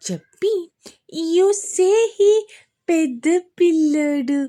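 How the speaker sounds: put-on high, slow toddler talk; little girl voice. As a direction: babble it like a small girl. Vanya's child voice.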